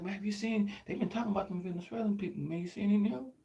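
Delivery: softly hammed-up nervous questioning; acted paranoia. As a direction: ask it furtively, as if paranoid. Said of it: "See, I'm paranoid".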